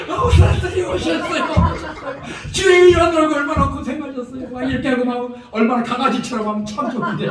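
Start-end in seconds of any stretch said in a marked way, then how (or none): 0.1-4.5 high, loud, laughing mock welcome; hammed-up, excited homecoming greeting; gushing welcome. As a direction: play an overjoyed wife greeting her husband. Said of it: "이렇게 하면 얼마나 좋을까".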